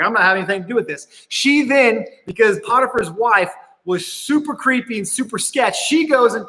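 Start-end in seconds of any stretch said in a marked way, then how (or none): 0.0-1.3 quick dismissal. Retold or imitated. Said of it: "He's like".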